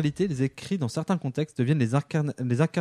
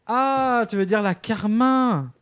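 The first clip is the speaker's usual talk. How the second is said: high, drawn-out "aah" of realization; high mock-enlightened exclamation; exaggerated realization; 7 semitones higher, much slower than usual. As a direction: stretch it high, as if it finally dawns. Mocking mishearing of a shade.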